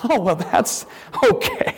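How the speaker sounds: ordinary delivery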